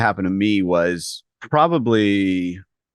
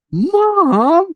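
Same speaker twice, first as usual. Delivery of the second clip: high, loud, mock-whined "Mom"; shrill embarrassed cry of "Mom," hammed up; mortified protest; 5 dB louder than usual. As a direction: yelp "Mom" like a teenager begging her to stop.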